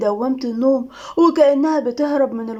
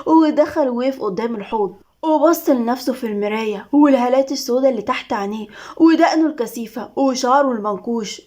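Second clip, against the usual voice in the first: ordinary delivery, nothing marked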